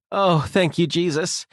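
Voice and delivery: high, heartfelt sigh of thanks; almost groaning relief. A narrator's reading. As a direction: let relief nearly turn into a groan. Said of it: "almost groaning as she boarded".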